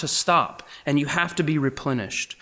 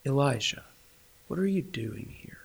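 tenderly; 6 dB quieter than usual. Shown as a demonstration. God tenderly asking weary Elijah. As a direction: gently draw him out. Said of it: "he asked it very tenderly".

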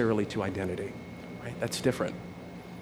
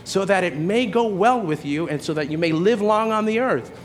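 put-on high, raised preachy yell at a child; 7 semitones higher, 3 dB louder than usual. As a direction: retell yourself yelling scripture across the house. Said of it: "I sort of yelled out".

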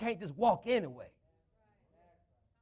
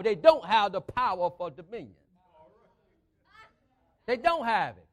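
forceful preaching; 6 dB louder than usual. Real, not acted. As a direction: punch out key words loudly.

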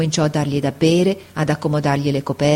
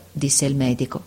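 plain reading voice

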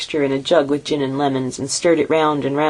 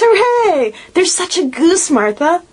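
put-on much higher, louder kids cheering; excited children; 4 dB louder, slower than usual. A narrator's reading. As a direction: cheer about the goose. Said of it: "cried the two young Cratchits".

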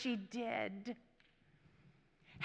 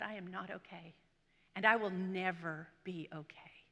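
very soft, low, hushed reply; retold despair; 4 semitones lower, 7 dB quieter than usual. Crying woman answers a stranger on the church steps, quiet despair. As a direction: recall despair in a subdued, low voice.

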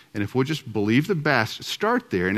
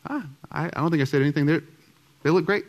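a light, higher take on his wife's breezy reply; imitating wife; 6 semitones higher, faster than usual. Husband voicing his wife shrugging off the blinds, lightly higher. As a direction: lift the voice a bit and toss it off casually. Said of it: "She goes".

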